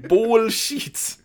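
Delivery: BULLSHIT high and loud in a mock Russian accent; attempted Russian accent. Reading the book's double O's, exaggerated Russian accent. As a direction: stretch the vowel of Bullshit, Russian-style. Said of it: "With, like, double O's".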